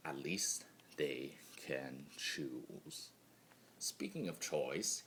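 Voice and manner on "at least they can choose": slower, softer wistful repeat; slow quiet echo. Acted. Chaos spirit envying others' choice, slow hushed echo.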